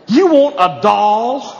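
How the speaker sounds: slow, teasing dad; mocking dad. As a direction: drag it out to mock the boy. Dad mocking son's toy.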